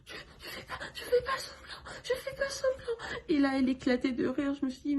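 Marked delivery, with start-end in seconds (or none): 0.0-3.0 high, half-whispered, stammering whine with laughter; mocking imitation. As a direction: parody a feeble patient, squeaky and breathy. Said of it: "elle m'imitait en disant".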